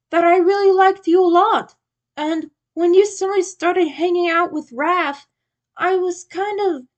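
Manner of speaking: blurting out a crush; nervous confession. A narrator's reading. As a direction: Leo admitting he likes the reader. Leo confesses feelings.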